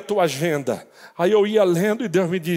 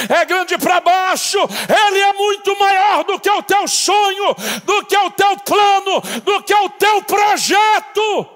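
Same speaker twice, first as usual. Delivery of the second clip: much higher and louder preached shout; preaching at full force; 11 semitones higher, 6 dB louder than usual. Acted. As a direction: drive each line harder to the peak. Preaching climax to congregation.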